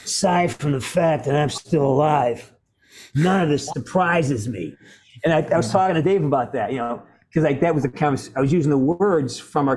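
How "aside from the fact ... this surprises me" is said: put-on old-man character voice, measured delivery; weighty, deliberate old veteran; Woods. Shown as a demonstration. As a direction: play the aged survivor, slowing on the last phrase. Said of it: "And then I go like this".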